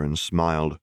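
usual speaking voice

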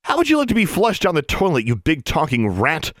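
high, sharp threat slowing at the end; threatening the talking rat, annoyed; snapping. Acted. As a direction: snap back at the rude pet. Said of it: "Alexandra snapped at the Jarvie".

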